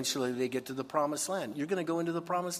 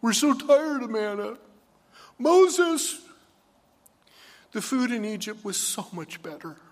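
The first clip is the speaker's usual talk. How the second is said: much higher, louder nasal-free whine; whiny tired Israelites; 9 semitones higher, 8 dB louder than usual. Retold or imitated. Preacher mimics the Israelites sick of manna, whining. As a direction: whine like spoiled children. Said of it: "they start going".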